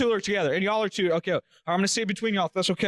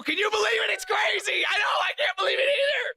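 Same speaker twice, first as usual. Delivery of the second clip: high-pitched hammed-up amazement; mock disbelief; 11 semitones higher than usual. Trivia host reacts to the actual quote, mock disbelief. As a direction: squeal it in fake astonishment.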